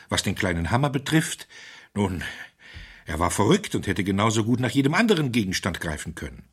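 high, loud, racing explanation; feverish. A narrator's reading. Wilfrid lays his theory before the doctor, high and feverish. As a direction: pour out the theory in feverish triumph. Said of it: "rief er fieberhaft".

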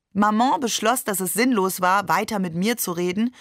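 plain reading voice

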